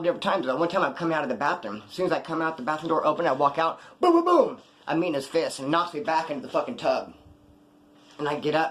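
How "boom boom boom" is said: high, loud vocal booms; rapid punch noises. Retold or imitated. Storyteller mimicking fists hitting him, explosive booms. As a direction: fire off explosive impact sounds. Imitates punches outside the bathroom.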